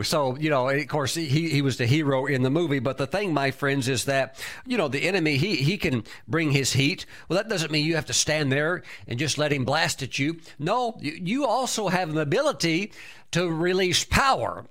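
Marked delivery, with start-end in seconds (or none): none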